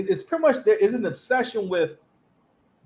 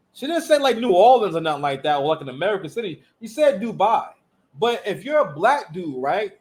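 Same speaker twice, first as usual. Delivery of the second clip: ordinary delivery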